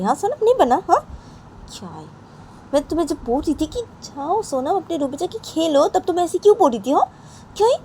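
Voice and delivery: high, loud telling-off; scolding voice. Acted. Poonam scolding Sonam for not listening, high and loud.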